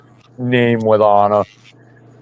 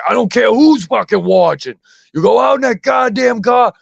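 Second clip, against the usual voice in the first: put-on scolding the kid, raised and furious; angry dad yell; 10 semitones higher than usual. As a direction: yell it like you mean business. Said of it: "I say".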